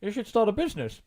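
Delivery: repeated through a mouthful; mouth full. Acted. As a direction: say it again while still chewing. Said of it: "I'm talking with my mouth full".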